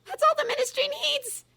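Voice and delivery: high, loud eager cry; cried out. A narrator's reading. Umbridge crying out eagerly to Ron, high voice. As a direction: cry out in relief. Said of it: "she cried".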